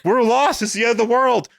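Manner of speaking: high, hammed-up mock panic; melodramatic despair. Somebody as all the lights go out, mock despair. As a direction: wail it like a doomed bystander. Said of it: "somebody's like".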